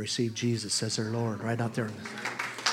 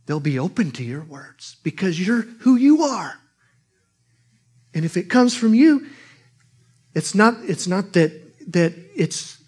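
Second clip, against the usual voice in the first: loud, high preaching burst; 5 semitones higher, 7 dB louder than usual. Acted. Preacher assuring the flock, loud and high. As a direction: press the point hard.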